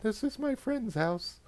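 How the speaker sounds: meek protest. Retold or imitated. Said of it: "and I'm like".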